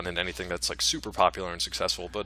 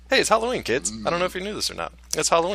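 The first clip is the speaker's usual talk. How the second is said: excited; 7 semitones higher, faster than usual. Acted. Playful Halloween announcement, higher.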